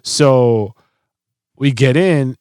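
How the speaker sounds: ordinary talk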